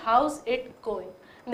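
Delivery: drawn-out How's it going; slow demo. Shown as a demonstration. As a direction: say it slowly, letting each word ring. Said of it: "देखिए मैं कैसे sound use कर रही हूँ". (धीमे, खिंचे स्वर में "How's it going?")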